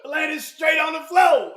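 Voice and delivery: slow, loud and laughing; laughing shout. Real, not acted.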